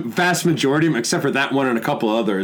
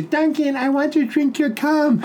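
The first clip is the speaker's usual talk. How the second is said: very high, slow falsetto woman's voice; imitated girlfriend; 12 semitones higher, slower than usual. Comic quoting neighbour's girlfriend through the wall, high falsetto impression. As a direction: put on a squeaky-high pleading girlfriend.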